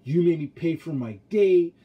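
mock-whiny imitation of his date; mocking her complaint. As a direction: mimic her indignant complaint, drawn out. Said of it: "And she's like".

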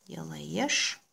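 ordinary talk, nothing marked

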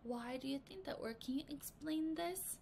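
soft unvoiced questions; whispered teacher comment. Shown as a demonstration. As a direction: whisper the margin notes.

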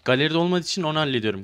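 ordinary talk

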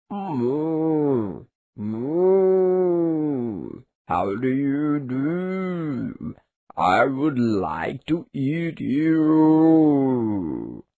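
slow, mooing cow voice; storybook cow, slow and drawn out. A narrator's reading. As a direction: draw every word out like a lazy cow. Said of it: "said the cow".

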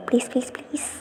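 whispered begging, barely a breath; pleading whisper. A narrator's reading. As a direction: beg him in a hush. Sister begging brother softly. (കെഞ്ചുന്ന മന്ത്രണം)

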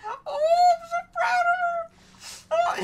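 very high, slow, wailing fake sob; hammed-up teary pride; mock crying. Streamer proud of a friend, pretending to cry. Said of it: "I'm trying to cry here".

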